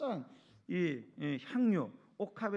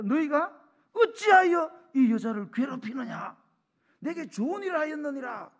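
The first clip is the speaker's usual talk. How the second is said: Jesus defending her; 10 semitones higher, 11 dB louder than usual. Acted. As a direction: speak as Jesus shielding the woman. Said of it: "이렇게 해요".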